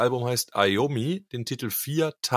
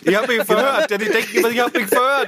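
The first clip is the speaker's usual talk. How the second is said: Nachäffen; 8 semitones higher than usual. Pretend. Mock voice of listener.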